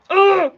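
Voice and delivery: loud, high exasperated "ugh"; short loud burst of frustration; frustrated groan. Real, not acted. Crafter giving up on the clay packaging, frustrated groan.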